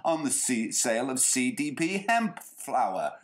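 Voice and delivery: ordinary delivery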